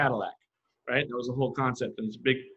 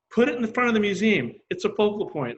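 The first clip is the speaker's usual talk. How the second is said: louder, higher excited command; excited curator; 7 semitones higher, 3 dB louder than usual. Retold or imitated. Curator excitedly orders the bus out front. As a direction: announce it like a sudden great idea. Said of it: "And Jeffrey's like".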